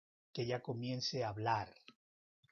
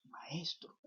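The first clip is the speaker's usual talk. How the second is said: whispered; 3 semitones higher, 9 dB quieter than usual. Acted. Mary recognizes Jesus.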